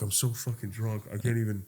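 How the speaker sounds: low, very soft re-enacted drunkenness; drunken helplessness. Drunk man failing to give chase, soft and helpless. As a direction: sink low and quiet, giving up.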